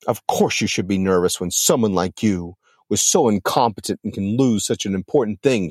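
plain reading voice

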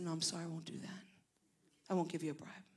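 quiet, firm whisper; hushed but firm no; hushed refusal. Retold or imitated. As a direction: turn the bribe down under your breath.

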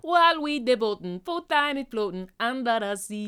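hammed-up Sebastian; mock Sebastian lyric. Co-host hamming up Sebastian's Caribbean lyric, slow sing-song. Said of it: "co-host echoes lyric".

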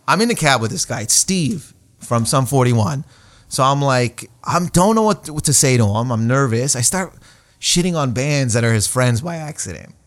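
usual speaking voice

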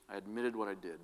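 normal talking voice

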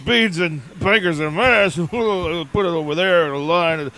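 low, rambling football commentator; John Madden. Acted. Football commentator ordering English food, low bumbling impression. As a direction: stumble through it like play analysis, pitched low. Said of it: "him ordering English food".

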